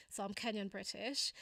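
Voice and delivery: ordinary delivery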